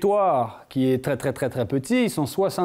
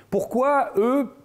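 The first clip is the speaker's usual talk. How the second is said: puzzled question; 10 semitones higher, much slower than usual. Real, not acted. Rhetorical question to audience.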